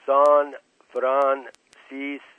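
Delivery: emphatic, drawn-out place name; slow enunciation. A narrator's reading. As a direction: spell it out for a slow listener. Told to open ears, spells out.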